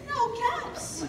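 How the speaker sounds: high and startled; dismayed. A narrator's reading. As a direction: gasp it out in disbelief. Peddler searching for caps.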